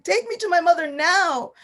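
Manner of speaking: crying demand. Acted. Krishna demands to be taken to his sick mother, now loud and tearful. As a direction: push it harder, a crying child who won't wait. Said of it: "He begins crying".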